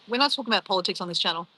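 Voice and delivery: ordinary delivery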